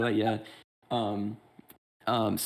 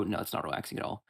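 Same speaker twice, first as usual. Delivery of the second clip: sarcasm; 5 semitones lower, 6 dB quieter than usual. Shown as a demonstration. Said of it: "that was sarcasm".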